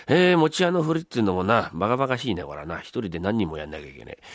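ordinary talk, nothing marked

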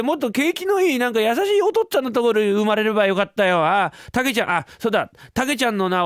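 put-on child's pointed grumbling; a hint of a self-pitying little boy; lightly voiced sulky boy. Boy wishing for a kinder father, lightly voiced sulking.

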